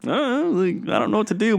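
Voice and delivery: high-pitched, helpless younger self; mock a green kid's high, lost voice; young, out-of-his-depth self. Green airman fretting over his yelling boss, high-pitched helplessness. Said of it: "hundred fifty-five pound me was like".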